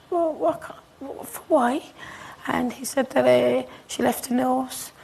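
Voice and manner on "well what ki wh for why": higher, halting, lost for words; startled disbelief. Retold or imitated. Mother asking her son why, startled and halting. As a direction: stumble over the question in shock.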